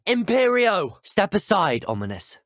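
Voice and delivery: high, forceful incantation and order; commanding spell cast. Acted. Casting a spell, giving an order.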